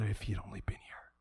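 hushed, hurried, low whisper; grieving whisper. Acted. Sisters talk to each other over the grave, grieving whisper. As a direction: whisper it under the breath in regret.

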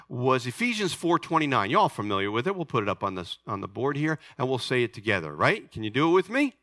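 ordinary delivery

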